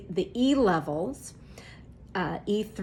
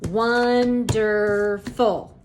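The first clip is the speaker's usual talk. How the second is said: "wonderful" stretched very slow and louder; drawn out word; 5 dB louder, much slower than usual. Shown as a demonstration. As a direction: draw out "wonderful" syllable by syllable, loudly. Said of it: "Now say".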